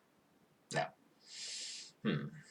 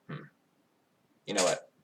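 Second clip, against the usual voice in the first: normal talking voice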